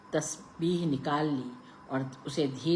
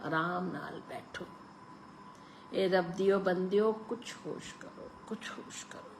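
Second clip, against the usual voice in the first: softer, fading to a whisper; old woman's lips barely whisper the plea; faint whisper; 4 semitones higher, 6 dB quieter than usual. A narrator's reading. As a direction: murmur it like a ghost. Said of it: "क्षीण सी फुसफुसाहट".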